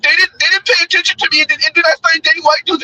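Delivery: high mocking imitation of a complaining woman; mocking whiny woman. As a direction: whine it up high, mocking her.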